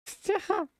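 bright squealing giggle; high squeaky laugh. Real, not acted. Reacts to chat's anime girl remark.

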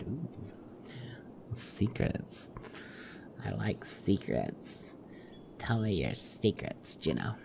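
hushed, slow, soft relishing of secrets; mock sultry whisper. Player teasing Juno for her secrets, mock hushed. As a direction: savour the word like a delicious secret.

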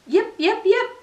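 high yipping fox call; fox yips. A narrator's reading. Little fox calling toward the music, high yips. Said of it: "She perked up her ears and called".

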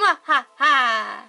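mad scientist. Pretend. Spooky lights-off evil laugh.

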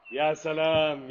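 mock delight. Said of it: "repeats phrase differently".

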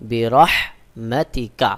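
slow বি রহমাতি কা, the h from mid-throat. Shown as a demonstration. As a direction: draw out রহমাতি with a strong throat h. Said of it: "হা রয়েছে এটা হলকের মধ্যখান থেকে উচ্চারণ হবে".

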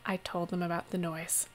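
barely holding his patience; weary mayor keeping his temper; strained patience. Acted. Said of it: "holding on to all of his patience".

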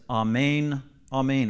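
"amen" as ah-MAYN, drawn out; Hebrew amen. Shown as a demonstration. Teacher explaining truly, truly, Hebrew "amen" ah-MAYN. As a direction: say "amen" slowly with a long "ay" ending. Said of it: "in the Hebrew, it's amen".

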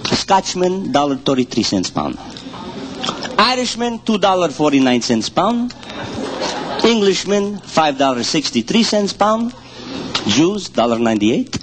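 put-on broken-English Ukrainian immigrant accent telling jokes; thick Ukrainian accent, dropped articles. Comedian telling hunting jokes to an audience in a Ukrainian accent.

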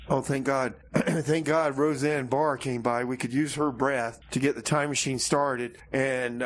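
ordinary talk, nothing marked

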